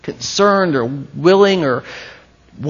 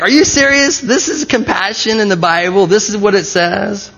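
higher-pitched incredulous questioning; mock disbelief; 4 semitones higher than usual. Preacher reacting to a long Greek word, mock disbelief. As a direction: jump up high, as if you can't believe it.

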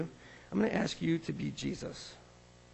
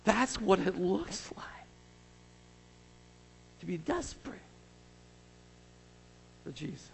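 hushed whisper; 5 dB quieter than usual. Real, not acted. Preacher's hushed reflection.

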